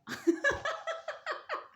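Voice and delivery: shrieking laugh at the memory; high burst of laughter. Real, not acted. (éclat de rire aigu)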